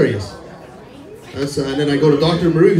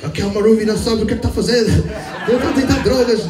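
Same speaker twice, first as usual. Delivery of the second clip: imitating mom in Portuguese; 9 semitones higher than usual. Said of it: "My mom".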